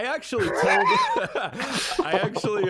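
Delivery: high, drawn out through laughter; laughing. Real, not acted. Speaker cracking up while telling the animator story, laughing.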